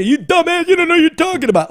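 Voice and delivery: high-pitched, quick heckling jeer; hammed-up indignant complainer; mock angry heckler. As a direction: imitate an irate critic in a high, rushed voice. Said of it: "getting a lot of blowback from Canada".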